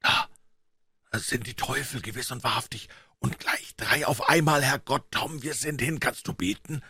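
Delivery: high, hushed, trembling fright; terrified boy keeping his voice down; shuddering whisper. A narrator's reading. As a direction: shudder out the words under your breath. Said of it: "Alsbald flüsterte Huck schaudernd".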